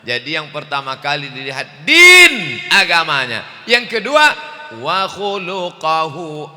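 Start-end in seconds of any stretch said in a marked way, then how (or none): 1.8-6.5 loud, rising preacher's cry; loud sermon delivery; forceful preaching. Real, not acted. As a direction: preach it out to the crowd.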